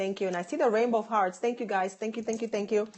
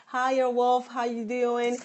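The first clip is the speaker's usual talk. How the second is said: normal talking voice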